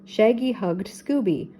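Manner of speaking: plain reading voice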